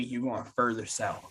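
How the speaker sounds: ordinary talk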